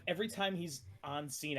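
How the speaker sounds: ordinary talk, nothing marked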